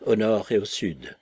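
usual speaking voice

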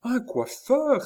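high, loud, drawn-out exclamation; overjoyed cry. A narrator's reading. Countess hearing a hairdresser has come, crying out. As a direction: cry it out like a miracle. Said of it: "s'écria madame du Barry".

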